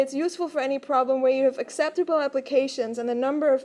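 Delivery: ordinary talk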